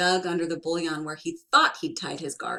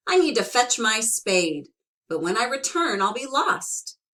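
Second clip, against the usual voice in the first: worried; 5 semitones higher, 7 dB louder than usual. Acted. Tom worried about losing spot.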